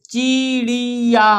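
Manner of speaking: चिड़िया lent, en trois syllabes longues; étire चिड़िया syllabe par syllabe; lecture syllabée. Shown as a demonstration. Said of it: "हमारे साथ पढ़ना है आपको".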